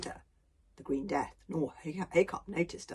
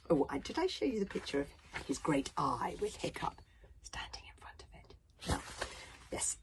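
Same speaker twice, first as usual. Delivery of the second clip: whispered aside; 5 dB quieter than usual. Real, not acted. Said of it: "reader's aside to listeners".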